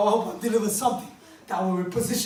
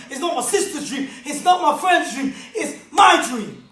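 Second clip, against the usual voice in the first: full-force preaching; 5 semitones higher, 5 dB louder than usual. Acted. Motivational speaker drilling the audience on their dreams, shouting sermon. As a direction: hammer each question home to the room.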